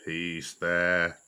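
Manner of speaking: put-on low, gurgling old elephant voice; Hathi. A narrator's reading. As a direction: rumble it low as a calming elephant. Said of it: "gurgled Hathi".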